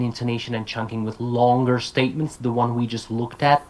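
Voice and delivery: usual speaking voice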